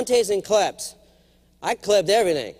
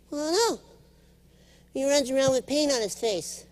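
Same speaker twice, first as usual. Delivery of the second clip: put-on higher, childlike voice; light childlike voice of his daughter; little daughter; 7 semitones higher, 4 dB quieter than usual. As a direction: explain like a small girl. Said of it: "my daughter's talking".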